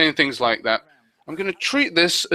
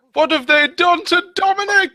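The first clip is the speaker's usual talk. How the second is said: high, hammed-up dismay; mock horror; 12 semitones higher, 3 dB louder than usual. Host seeing what was done to Dominic, mock horror. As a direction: wail it in pretend outrage.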